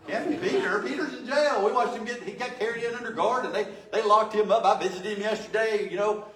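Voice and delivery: high, loud, laughing dismissal; mocking disbelief. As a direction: scoff at the news through laughter. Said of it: "But they go, what is their response?".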